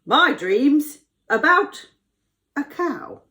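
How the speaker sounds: high, slow, dramatic announcement; Pharaoh voice. Acted. Pharaoh reveals his dream to Joseph, dramatic announcement. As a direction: declare it grandly with pauses. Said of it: "he said".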